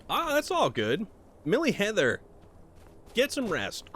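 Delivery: ordinary delivery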